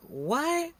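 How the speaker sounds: a sudden baffled squeak; startled what. Retold or imitated. Narrator replaying his shock when the lady grabs his food, startled.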